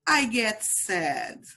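slow, clear model for repeating; teacher modelling for children to repeat; slow model phrase. Shown as a demonstration. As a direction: say it slowly for the class to copy. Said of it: "repeat with the teacher".

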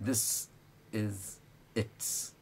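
"this", "is", "it" each said alone; slow drill with every word separated. Shown as a demonstration. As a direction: pause after "this" and after "is". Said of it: "Now, let's say it together".

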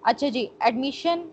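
ordinary talk

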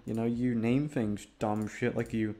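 usual speaking voice

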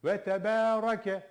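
Ve tebarake with a heavy ra, slow and loud; thick ra. Shown as a demonstration. Teacher showing the thick ra in tebarake. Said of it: "Hakkını çıkartalım".

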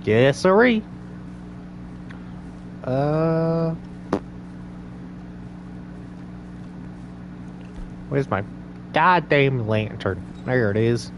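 put-on folksy Southern accent; Southern accent character. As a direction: talk like a Southern cowboy character. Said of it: "my southern and Australian are the same".

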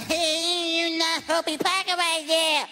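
high scolding voice; other driver voice. Retold or imitated. Driver scolding about the handicapped spot, high.

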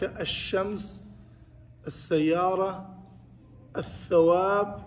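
"الشمس", "السيارة", "الثواب" with doubled first consonant, no l; unpronounced lam. Shown as a demonstration. Teacher lists examples of silent lam, assimilated article in each word. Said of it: "but you don't pronounce".

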